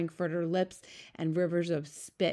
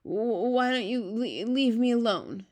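halting, high-pitched plea; nervous stammer; 5 semitones higher, 3 dB louder than usual. A narrator's reading. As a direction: catch on the first sounds, pleading nervously. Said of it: "he stammered".

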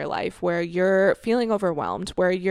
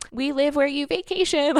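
cheesy schoolgirl boast; 7 semitones higher than usual. Retold or imitated. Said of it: "Like, so cheesy".

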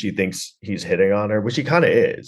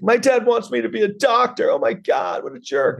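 high-pitched mock complaint about dad; hammed-up, high whine of a put-upon son; whining movie son. Mocking a film's son griping about his dad, high whine. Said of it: "He's like".

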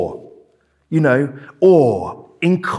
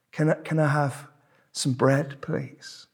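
put-on soft, timid-sounding child's request; a hint of a child, softly asking; lightly voiced child; 8 dB quieter than usual. Hungry child asking its parent for bread, lightly voiced child. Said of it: "Imagine a child asking its parent".

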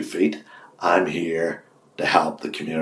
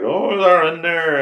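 knowing gossip; 11 semitones higher, 4 dB louder, much slower than usual. Retold or imitated. Voicing gossip about rivals.

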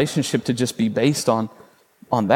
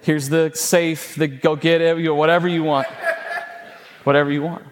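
gushing dad; 4 semitones higher than usual. Retold or imitated. Dad overwhelmed, offering everything.

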